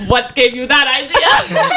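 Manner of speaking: put-on slow, playful Russian accent. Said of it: "that was my Russian accent".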